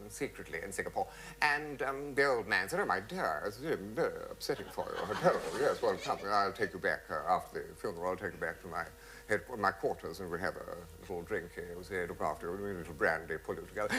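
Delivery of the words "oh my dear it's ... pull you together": consoling. Retold or imitated. Old man to the crying widow, consoling. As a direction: murmur mock comfort to someone upset.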